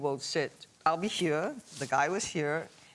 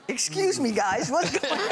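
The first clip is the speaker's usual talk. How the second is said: retold indignant query; 6 semitones higher than usual. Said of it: "looked at him and go like".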